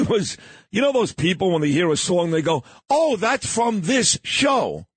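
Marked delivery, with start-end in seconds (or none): 2.9-4.8 higher, drawn-out mock recognition; slow, raised-pitch mimicking; mock clueless listeners. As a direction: lightly imitate people who only know songs from shows. Mimics people recognizing a song.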